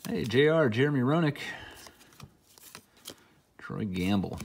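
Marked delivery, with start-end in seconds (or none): none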